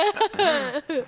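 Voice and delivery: squealing giggle; shrill panicked laugh; high nervous giggle. Real, not acted. Bugs on finger.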